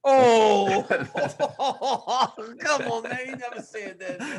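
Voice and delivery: high, wailing objection through laughter; laughing protest. Real, not acted. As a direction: cry out in disbelief, cracking up. Laughing protest at Leon's quoted remark.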